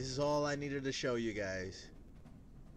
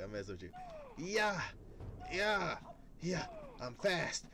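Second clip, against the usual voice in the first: normal talking voice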